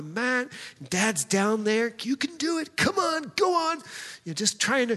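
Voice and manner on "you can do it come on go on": put-on high, urgent coaxing of a scared kid; egging a little boy onto the mat; coaxing dad. Dad urging his scared son onto the mat, high coaxing voice.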